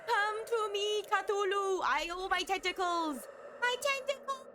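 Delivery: high hammy monster voice; sneering panto villain. Acted. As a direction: ham it up as a tentacle beast. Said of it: "I am becoming the tentacle monster".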